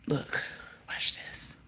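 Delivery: hushed, secretive whisper. Real, not acted. Vlogger shows viewers what the cat does, whispering. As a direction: whisper it on the sly.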